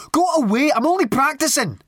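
very high, loud, indignant yell; shouting. A narrator's reading. Piper to couple offering coins, shouting. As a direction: yell it high to drive them away. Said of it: "he shouted at them".

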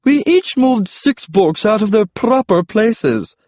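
high, slow stegosaurus call; comic high-voiced dinosaur; George the Stegosaurus. A narrator's reading. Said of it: "called George the Stegosaurus".

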